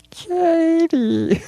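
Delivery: high eerie toy-doll call; haunting doll calling her name; creepy doll voice. Retold or imitated. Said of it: "she just hears".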